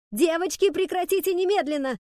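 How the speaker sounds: high, loud teacher's command; full-voice shout. A narrator's reading. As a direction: order pupils to stop at once, high and loud. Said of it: "Громко, во весь голос". (крик во весь голос)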